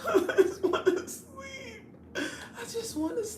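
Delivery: high, slow fake-crying whine through laughter; half-laughing, half-sobbing whine; mock whiny cry. Groggy morning self whining she just wants to sleep, fake-crying while laughing. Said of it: "I'm like".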